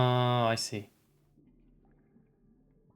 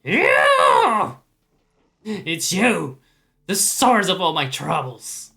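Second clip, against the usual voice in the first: angry raspy spectre; 4 dB louder than usual. Acted. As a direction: bellow at the ghost who ruined his zone. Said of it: "when I do this guy's voice".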